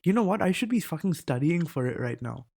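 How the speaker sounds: ordinary talk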